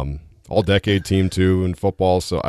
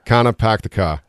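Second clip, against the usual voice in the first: New England "Kana, pack the car", every R dropped; thick New England vowels, no R in "car"; New England accent. Shown as a demonstration. Guest demonstrating for host, New England accent. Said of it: "I'd like you to back home say".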